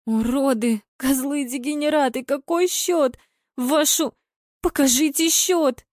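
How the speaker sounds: high-pitched, exasperated cursing; agitated outburst. A narrator's reading. Marina cursing the TV for hiding the score, agitated. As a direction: curse the broadcast in a frantic high voice. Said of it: "Нервничала Марина".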